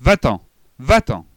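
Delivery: loud yelled command from under the covers; yelling. A narrator's reading. Child screaming at her mother to leave the room. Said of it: "je hurle".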